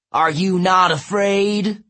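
loud, high, slow giant's challenge; taunting giant. A narrator's reading. Giant testing the girls' courage, taunting. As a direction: draw out the question menacingly.

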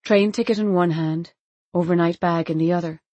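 ordinary delivery

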